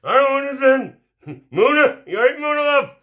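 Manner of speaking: high-pitched excited hail; calling out to Mona. Real, not acted. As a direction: sing out a greeting way up high. Hailing Mona as she joins.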